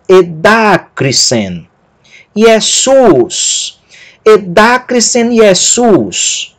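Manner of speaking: edacrisen with long DA, Iesus with long SUS; e-DA-crisen, ie-SUS, stretched stressed syllables; correctly accented reading. Shown as a demonstration. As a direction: read slowly, leaning on da in edacrisen and sus in Iesus. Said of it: "Agora podemos ler com a devida acentuação".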